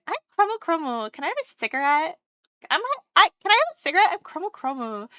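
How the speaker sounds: lightly imitating an introduction; Chromo. Retelling a friend asking for a cigarette, light impression. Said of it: "She just walked up and went".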